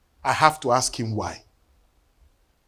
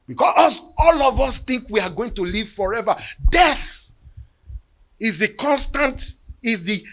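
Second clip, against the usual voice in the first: preaching; 7 semitones higher than usual. Acted. Pastor warning the congregation about death, preaching at full force. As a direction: proclaim it to the whole church.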